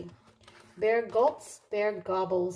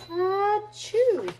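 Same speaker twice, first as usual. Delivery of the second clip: big, much higher and louder sneeze; bear sneeze; 13 semitones higher, 6 dB louder than usual. A narrator's reading. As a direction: burst out a huge "Ah, chew" like a sneeze. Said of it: "He blows and he sneezes".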